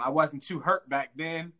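normal talking voice

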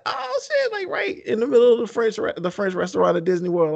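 choked-up high cry; emotional crying. Real, not acted. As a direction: voice breaking up high with tears. Moved recalling friend's proposal video.

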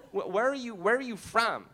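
put-on curious American accent, stammered start; mimicked American accent. American asking where he is from, mock American accent. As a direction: ask like a puzzled American. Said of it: "They're always like".

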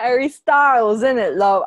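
loud, badly faked British accent; bad put-on accent. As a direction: attempt a British accent and overdo it. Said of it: "I can't do an accent".

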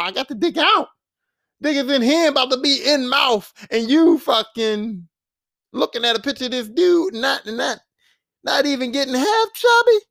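high-pitched, mock exasperated rant; exasperated complaint. Woman berating James for ignoring her, exasperated. Acted quarrel, complaining he isn't interested.

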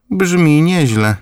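high, slow grandmother's voice; grandma voice. A narrator's reading. Grandmother approves her grandson's job offer, high and slow. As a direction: approve slowly and knowingly.

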